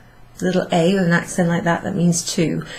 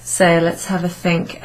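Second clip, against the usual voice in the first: normal talking voice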